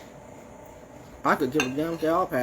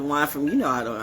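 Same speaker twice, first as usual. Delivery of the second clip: ordinary delivery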